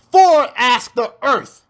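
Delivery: slow, weighty reading; slow scripture reading. Shown as a demonstration. Preacher reading verse nine to the congregation, slow. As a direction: read it out slow and grave. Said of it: "Verse nine".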